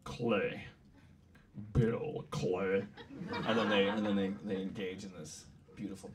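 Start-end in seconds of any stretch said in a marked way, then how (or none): 0.0-2.8 lightly voiced film villain, slightly lowered; hint of a measured villain, pausing before the full name; understated movie-villain imitation; Alan Rickman. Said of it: "Alan Rickman".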